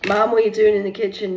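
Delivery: loud, high call of a nosy daughter. Retold or imitated. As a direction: call up from the other room. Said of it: "she used to call me".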